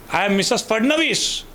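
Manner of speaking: high, loud announcing her name; a bossy nurse introduces herself; brisk head nurse. Retold or imitated.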